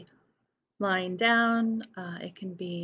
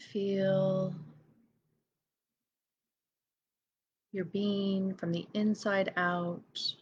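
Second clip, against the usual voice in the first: guided meditation. Real, not acted. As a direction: lead each breath gently, leaving silence between phrases. Leads a breathing meditation.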